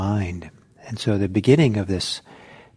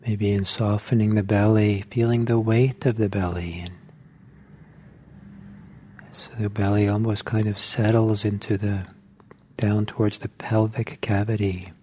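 guided meditation. Acted. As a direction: ease the group into stillness, one gentle cue at a time. Teacher leads a guided meditation.

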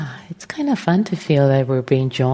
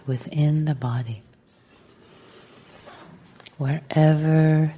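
guided meditation; 3 dB quieter than usual. Real, not acted. Teacher settling the group into meditation, calm and unhurried. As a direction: gently guide them into stillness, phrase by phrase.

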